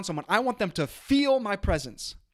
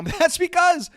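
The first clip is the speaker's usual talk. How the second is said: laughing; 11 semitones higher, 6 dB louder than usual. Real, not acted. Laughs at own yelling.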